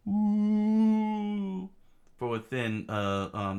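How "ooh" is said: long, high, loud "ooh" of wind; howling sandstorm laugh. Acted. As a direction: imitate a storm howling with glee. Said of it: "the sandstorm laughed".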